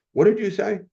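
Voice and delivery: sharp high startled question; wife startled asking what he said. Retold or imitated. Wife asks sharply in car.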